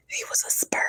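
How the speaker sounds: voiceless, half-crying; crying whisper; tearful whisper. Real, not acted. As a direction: choke it out in a whisper. Tearful joke about tiny baby.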